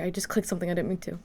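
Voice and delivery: ordinary talk, nothing marked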